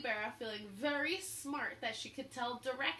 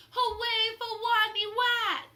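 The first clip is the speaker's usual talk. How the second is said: much higher, much louder cheer; 10 semitones higher, 12 dB louder than usual. A narrator's reading. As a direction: cheer for the hero. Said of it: "They cried".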